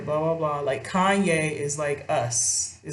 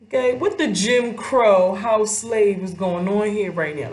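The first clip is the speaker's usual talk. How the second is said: loud, high what-the-hell reaction; higher and louder mock outrage; shocked disbelief; 5 semitones higher, 4 dB louder than usual. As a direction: react like you can't believe your eyes. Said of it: "that's my first thought".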